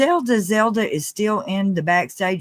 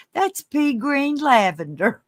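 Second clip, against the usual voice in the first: higher, slower mock of the friend; imitating her friend; 7 semitones higher, slower than usual. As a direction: drawl it like her friend did. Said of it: "She called it".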